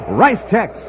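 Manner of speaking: normal talking voice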